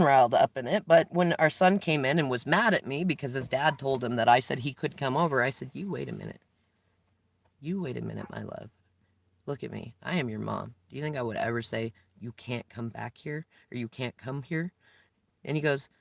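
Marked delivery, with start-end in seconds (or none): 5.7-14.7 gentle mom voice. Retold or imitated. Mother calmly reassures her son.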